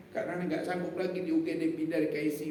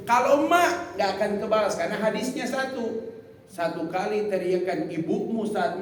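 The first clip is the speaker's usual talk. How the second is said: louder, higher fiery sermon; preaching at full force; 4 semitones higher, 6 dB louder than usual. Real, not acted. Preacher pressing the crowd about fathers, fiery. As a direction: rouse the congregation. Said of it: "preaching at full force".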